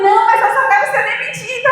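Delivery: high, fast and loud, pushing back; insistent and loud. Retold or imitated. Telling the boss she just wants to be fired, insistent and loud. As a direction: keep insisting without giving reasons.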